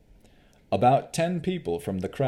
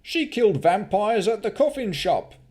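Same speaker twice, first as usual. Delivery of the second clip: indignant townsperson; 12 semitones higher, 5 dB louder than usual. Acted. Townsperson defending Navara.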